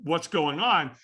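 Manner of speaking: ordinary talk, nothing marked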